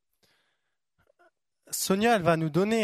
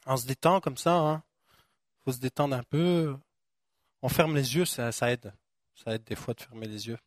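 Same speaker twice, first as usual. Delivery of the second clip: lower, soft relaxing voice; calming prayer lead; 5 semitones lower, 4 dB quieter than usual. Acted. Preacher guiding the congregation to relax, soft low voice. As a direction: ease them into calm.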